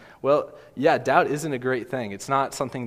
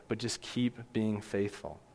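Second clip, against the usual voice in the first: soft slow urging; 8 dB quieter, slower than usual. Acted. Preacher softening on the exhortation.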